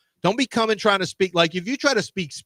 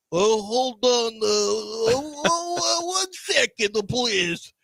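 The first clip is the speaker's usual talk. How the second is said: high, drawn-out bad Russian accent; Borat; 5 semitones higher, much slower than usual. Bluffer stalling a Russian-speaking visitor, Borat-style accent. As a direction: stall slowly in a hammed-up foreign accent. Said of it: "like, uh, Borat, basically".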